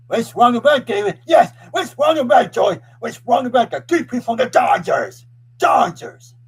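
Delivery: loud, high-pitched fan outburst; fired-up shouting. Real, not acted. Fan defending the Dodgers three-peat to Joy, fired-up shouting. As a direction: shout each phrase high and hard.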